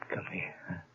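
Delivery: soft whispered invitation; an intimate whisper to his wife. Acted. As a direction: breathe it tenderly to her. Tender moment with new mother.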